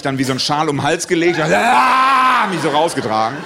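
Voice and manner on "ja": loud, high grunt of lifting; strained effort grunt. Retold or imitated. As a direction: heave it out like lifting a heavy man.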